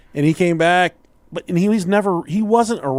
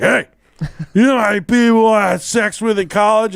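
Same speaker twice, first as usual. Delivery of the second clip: put-on louder, high-pitched drunken insistence; drunk brother; 8 semitones higher, 5 dB louder than usual. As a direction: demand attention like a pushy drunk. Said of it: "he was drunk and fall".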